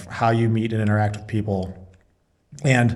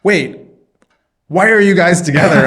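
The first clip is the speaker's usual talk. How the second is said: higher, louder baffled question; surprised question; 8 semitones higher, 7 dB louder than usual. Retold or imitated. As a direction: pull up short, amazed. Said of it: "he's like".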